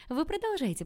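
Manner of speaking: a burst of amused eagerness; eager urging. A narrator's reading. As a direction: egg her on with delight. Eager girl urges her on.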